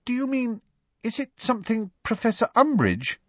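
high, hesitant student voice; halting, careful question; tentative student. A narrator's reading. Parvati asking about Umbridge, tentative.

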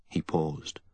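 usual speaking voice

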